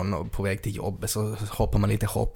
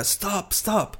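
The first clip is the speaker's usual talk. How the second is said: high-pitched mock cries of stop; high, unforced pretend plea; mock protest; 10 semitones higher, 3 dB quieter than usual. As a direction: imitate a protesting woman, pitched up without shouting. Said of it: "hon är bara sådär".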